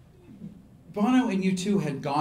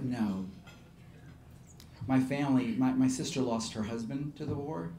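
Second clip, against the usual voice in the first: softer, lower, understated sorrow; subdued confession; 3 semitones lower, 7 dB quieter than usual. Retold or imitated. Refugee telling singer of war losses, subdued. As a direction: sink quietly as you confide, voicing her lightly. Refugee confides loss and guilt.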